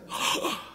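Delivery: a short breathy gasp of surprise; retold shocked gasp. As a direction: imitate his startled intake of breath. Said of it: "he was shocked".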